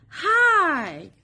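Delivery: plain reading voice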